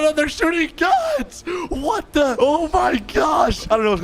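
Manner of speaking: very high-pitched alarmed shouting; panicked shriek. Pretend. Player escaping while they shoot guns, panicked shriek. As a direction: squeal in gamer panic.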